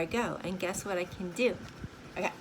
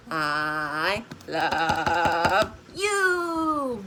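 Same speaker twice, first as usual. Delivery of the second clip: very slow, drawn-out I love you in a lower voice; husband's slow I love you; 3 semitones lower, much slower than usual. Retold or imitated. Husband saying it to giggling grandkids, very slow and low. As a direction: stretch each word out long like the husband does. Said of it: "as my husband says".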